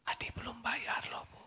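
high, unvoiced little whisper; child whisper. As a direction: whisper it to mum secretly. (bisikan anak kecil)

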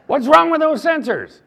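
high, loud angry demand; furious lieutenant shouting at a recruit; barking lieutenant. Retold or imitated. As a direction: bark it like an officer who's lost the drill. Lieutenant demanding answers, acted.

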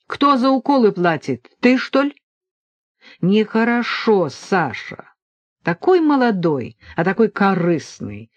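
ordinary delivery, nothing marked

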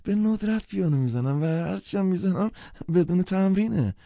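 high nervous whine of excuses; flustered pianist. Acted. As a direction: blurt out excuses in a high panic. Embarrassed pianist excusing himself.

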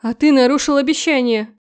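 громкий обвиняющий выкрик; бросить упрёк на грани истерики; истеричное обвинение. Acted. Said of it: "истеричным, обвиняющим тоном". (невеста обвиняет жениха, громкий истеричный тон)

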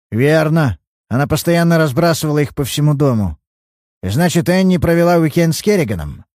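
a lightly voiced irritable old father.

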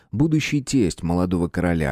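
plain reading voice

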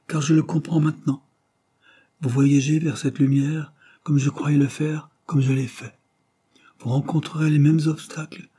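voix plus haute de mourant, solennelle; mourant qui bénit. Acted. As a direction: dernier adieu d'un père à ses enfants. Mourant parle à sa famille. (père mourant, voix haute et grave d'adieu)

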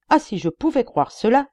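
exclamation plus forte, plus haute; lâche-le comme un cri d'espoir; s'écrie. A narrator's reading. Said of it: "s'écria-t-il". (le juge, pâle de colère, s'écrie devant Lecoq)

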